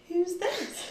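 mock surprise. Doorbell rings mid coming-out.

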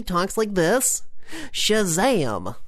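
Billy Batson. Acted. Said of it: "His voice is just, like, a little bit lower".

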